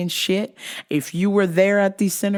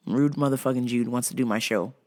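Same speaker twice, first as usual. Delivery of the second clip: ordinary delivery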